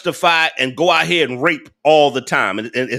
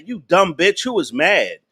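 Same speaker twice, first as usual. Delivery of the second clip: ordinary talk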